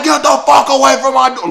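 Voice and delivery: high, loud furious screaming; she-devil screaming. Retold or imitated. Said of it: "She's like".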